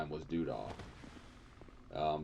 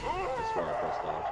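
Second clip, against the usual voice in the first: indignant outcry. Real, not acted. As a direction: squeal it in outrage. Protesting the in-game hit.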